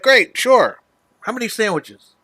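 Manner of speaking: high, loud and upbeat; bright mock-cheerful counter guy; cheerful deli guy. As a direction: chirp it like a happy clerk. Voicing the friendly deli guy.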